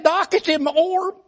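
high, tearful whine about pain; whimpering hypochondriac. Retold or imitated. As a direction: whine like every touch is agony. Arzy complaining.

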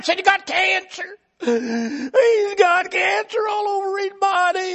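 high, slow, tearful wail; crying panic. Retold or imitated. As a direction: wail it out, close to sobbing. Ezra panicking to the doctor.

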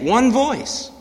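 "one voice" pitched high and pressed; stressed phrase. Shown as a demonstration. Preacher stressing "one voice" for the congregation, pitched high. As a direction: lean hard on "one voice". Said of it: "Interesting how that's stressed there".

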